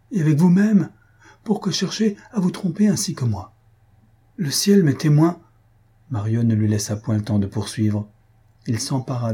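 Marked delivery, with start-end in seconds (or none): 0.1-5.4 high, urgent appeal; impassioned pleading. A narrator's reading. Two lovers argue over hidden feelings, pleading with passion. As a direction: plead with rising insistence.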